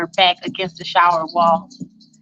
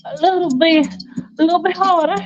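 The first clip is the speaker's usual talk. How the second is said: rising ever higher as she urges; breathy urging girlfriend; 9 semitones higher than usual. Retold or imitated. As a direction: climb in pitch, egging him on.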